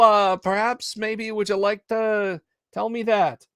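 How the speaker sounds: a pushy city slicker. Acted. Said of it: "his city slicker tone of voice".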